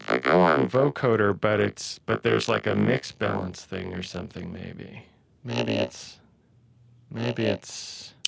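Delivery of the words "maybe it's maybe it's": android voice. Shown as a demonstration. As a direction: talk through the vocoder. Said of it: "an android voice".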